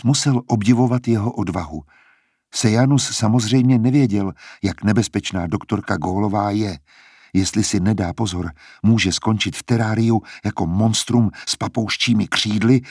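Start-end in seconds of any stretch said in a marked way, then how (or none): none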